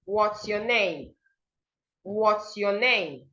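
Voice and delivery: slow "What's your name" falling on name; falling intonation. Shown as a demonstration. Teacher modelling a WH question, voice falling at the end. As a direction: draw it out and let "name" drop. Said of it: "your voice should fall".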